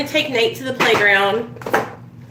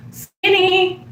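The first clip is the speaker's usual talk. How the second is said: app voice; 10 semitones higher, 3 dB louder than usual. Pretend. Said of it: "And it will say".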